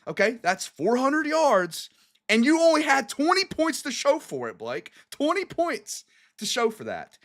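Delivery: high, stretched out in disbelief; exasperated rant. Real, not acted. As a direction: hammer home the frustration. Host venting over wasted yards.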